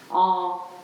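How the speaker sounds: ordinary talk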